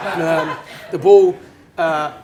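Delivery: can't get the words out for laughing. Real, not acted. Laughing at own boast.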